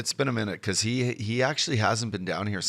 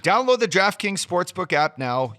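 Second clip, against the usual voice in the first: punchy promo read with disclaimer; 8 semitones higher, 6 dB louder than usual. Acted. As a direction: close the ad hard, rush the fine print. Ad read by host.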